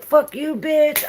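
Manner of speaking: high, mock-snarling curse; mean dream self. Telling viewers how she cursed in her dream, mock mean and high. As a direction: hurl it at them, playing her nasty dream self. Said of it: "I was so mean".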